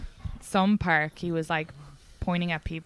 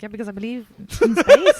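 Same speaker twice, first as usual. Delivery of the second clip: laughing retort; 7 dB louder than usual. Retold or imitated. Laughing at a date who doubts space travel. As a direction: toss it back, cracking up. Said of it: "And I was like".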